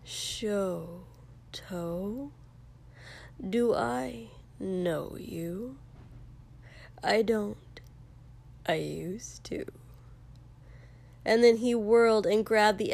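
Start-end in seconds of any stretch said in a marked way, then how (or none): 0.0-9.7 soft, slow, sinking to a whisper; horrible remembered voice. A narrator's reading. Dreaded voice heard in memory asking who he is, whispery. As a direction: let each phrase hang eerily, hushed. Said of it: "heard that horrible".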